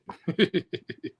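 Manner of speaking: short high burst of laughter; sheepish high laugh; embarrassed laugh. Real, not acted. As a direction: laugh at yourself for jumping early. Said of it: "I got excited".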